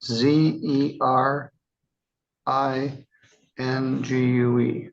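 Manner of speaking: slow, letter-by-letter spelling; spelling out slowly. Real, not acted. Judge checking a defendant's name, spelling slowly. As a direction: spell it out in slow, separate groups.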